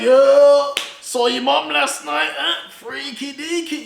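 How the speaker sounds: loud mocking yo then sly; teasing schoolboy. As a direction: rib him like a cheeky classmate. Schoolboys teasing his son.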